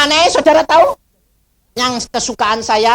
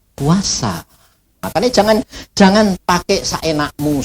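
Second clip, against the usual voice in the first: normal talking voice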